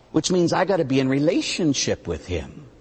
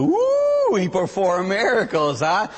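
sarcastic high woo, then scoffing; hammed-up sarcastic wonder; mock amazement; 4 semitones higher, slower than usual. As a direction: act wildly impressed to mock them. Priest mocks kid's answer.

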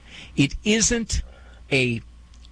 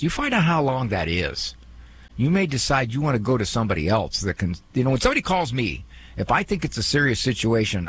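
usual speaking voice

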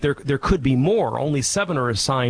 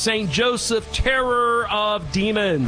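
higher, fast, sing-song benediction; priestly blessing; 6 semitones higher than usual. Real, not acted. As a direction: bless the listeners with ceremonial lift. Priest gives closing blessing.

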